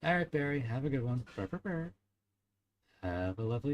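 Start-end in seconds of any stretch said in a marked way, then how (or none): 1.3-1.9 high, playful rolled brr; higher purring brr sound; silly sign-off trill. Real, not acted. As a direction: trill a quick goodbye purr. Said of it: "copying off other people, I go".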